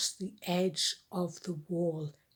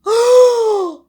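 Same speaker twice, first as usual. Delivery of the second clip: much higher, louder gasp of shock; 23 dB louder than usual. Acted. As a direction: gasp in horror. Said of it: "said his sisters".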